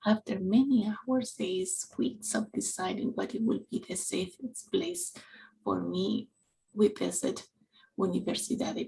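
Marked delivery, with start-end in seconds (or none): none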